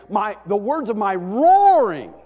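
high, very loud preacher's roar; full-voiced roar of desperation. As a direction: bellow it, stretching the last word. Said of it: "He is bellowing out for help".

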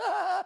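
a dutiful laugh at a bad joke; polite fake laugh. Congregation humoring Ann's joke, forced high laugh. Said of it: "everybody goes".

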